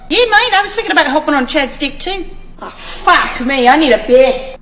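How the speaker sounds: a light mock Australian accent. Mocking how Australians talk, in a lightly put-on Australian accent. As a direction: ham up a bloke's Aussie banter. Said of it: "how I believe Australian conversations go".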